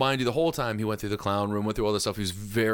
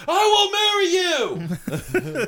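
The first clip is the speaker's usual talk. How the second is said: slow, high, loud wail; imitated panicked yell; 6 dB louder, much slower than usual. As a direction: scream it out as a mock plea. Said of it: "She would yell".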